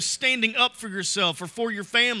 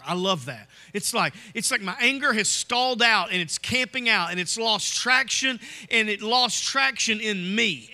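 normal talking voice

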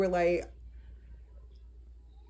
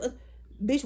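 ordinary talk, nothing marked

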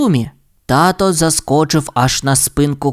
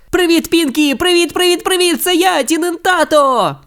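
much higher, gleeful greeting; excited shouting; 13 semitones higher than usual. A narrator's reading. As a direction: wave and yell to the stage. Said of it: "закричав". (захоплено вигукує)

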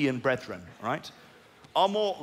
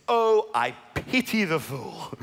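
mock Mr T; 5 semitones higher, slower than usual. Quoting Mr T catchphrase.

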